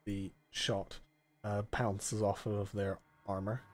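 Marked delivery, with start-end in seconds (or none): none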